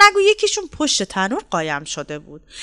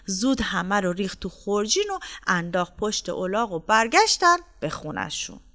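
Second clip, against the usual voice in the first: ordinary delivery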